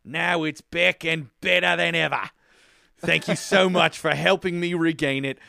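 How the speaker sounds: hammed-up, rough New Zealand accent. Host reading a listener's review, hammed-up New Zealand accent. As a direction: put on your best amateur New Zealand accent. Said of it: "do my best New Zealand accent".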